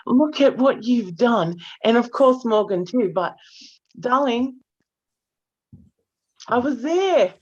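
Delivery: ordinary talk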